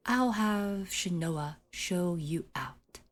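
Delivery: normal talking voice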